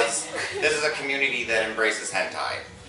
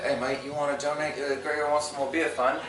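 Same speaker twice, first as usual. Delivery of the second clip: imitated foreign accent, softer; Gregor's accent; 7 dB quieter than usual. Gregor offering a dance for donations, imitated accent. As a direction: put on a friend's amazing accent. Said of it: "in that fucking amazing accent".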